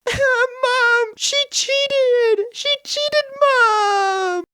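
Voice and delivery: very high-pitched, hammed-up crying wail; child's tantrum. Brother loses at Monopoly and runs to Mum, wailing in a tantrum. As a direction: bawl it like an outraged little kid. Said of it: "me throwing a tantrum to Mum".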